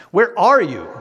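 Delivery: mad, high and loud demand; angry taunt. Acted. Hearer under hard trial challenging God, angry taunt. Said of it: "like a taunt, you know, like we're mad".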